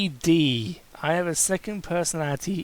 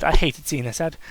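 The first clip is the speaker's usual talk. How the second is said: ordinary delivery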